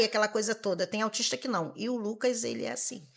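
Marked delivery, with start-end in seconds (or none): none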